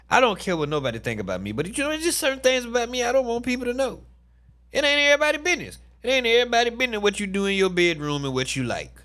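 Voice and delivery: high, rapid and worked up; fired-up fast venting; fast heated rant. Real, not acted. Ranting about privacy.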